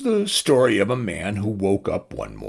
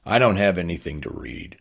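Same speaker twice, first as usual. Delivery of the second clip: plain reading voice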